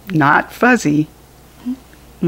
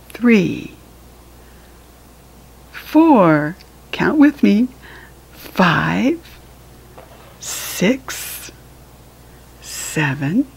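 usual speaking voice

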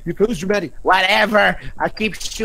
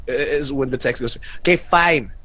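usual speaking voice

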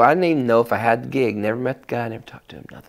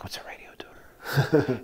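puzzled whisper; 3 semitones higher, 9 dB quieter, slower than usual. Retold or imitated. Retold confused aside.

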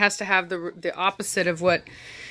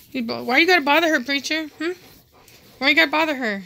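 high, louder playful scold; mock scolding; 8 semitones higher, 5 dB louder, faster than usual. Real, not acted. Owner scolding Preacher for bothering Cashmere, playful high scold. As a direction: tease the dog for pestering her.

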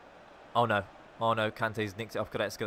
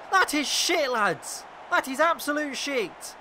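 furious rant; 10 semitones higher, 5 dB louder than usual. Real, not acted. Gamer ranting at his goalkeeper after conceding, furious. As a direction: rage at your own team.

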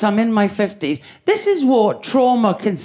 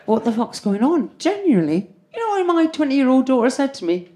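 normal talking voice

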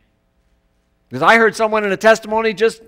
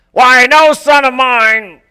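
stern father; 6 semitones higher, 8 dB louder, much slower than usual. Retold or imitated. Father disowning his son.

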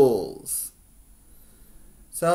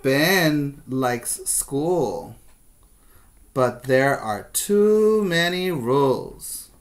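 slow, lively storybook read-aloud; reading to children; 3 semitones higher, 4 dB louder, slower than usual. Acted. Teacher reading the story to her kindergarten class. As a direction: read it to the class like a picture book.

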